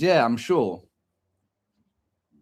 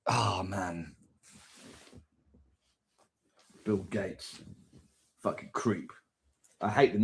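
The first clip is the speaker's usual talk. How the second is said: low, hushed disgusted mutter; muttered under breath; 6 semitones lower, 9 dB quieter, slower than usual. Real, not acted. Muttering disgust at Bill Gates.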